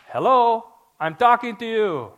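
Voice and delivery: loud, high put-on accent, a mock booming God; joking accent for God. God calling out to the doubting pastor, loud comic accent. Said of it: "Not in that accent".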